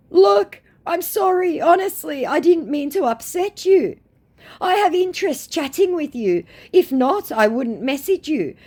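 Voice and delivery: high, mocking put-on voice for the scammer; whiny high imitation of a chat scammer.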